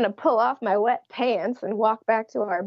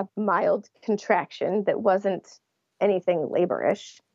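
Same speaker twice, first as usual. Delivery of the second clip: usual speaking voice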